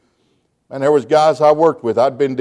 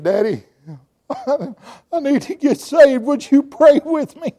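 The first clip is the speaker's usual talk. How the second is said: mock crying plea; 10 semitones higher, slower than usual. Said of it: "blubbering like a baby".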